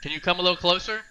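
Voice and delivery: high, loud, questioning kobold voice; hard-of-hearing shout. Acted. Older kobold asks Cedric to come closer, shouting high. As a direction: shout it like you can't hear. Kobold straining to hear the player.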